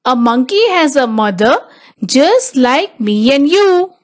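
ordinary delivery

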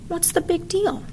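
high, soft faux-innocent child; innocent little girl. A narrator's reading. Kavita shrugging off the trouble she caused. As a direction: play dumb sweetly. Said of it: "innocent look".

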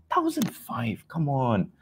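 high, breathy scoff at the price. Real, not acted. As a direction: sneer at the number.

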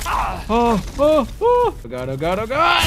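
panicked high yelps; real panic. Real, not acted. Player panicking as the huge spider attacks. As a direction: yelp in fright as it lunges.

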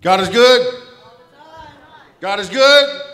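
shouted out with joy, full voice; loud call to the congregation. Real, not acted. A mourner greets the funeral crowd with a loud call. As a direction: proclaim it to the whole room, loud.

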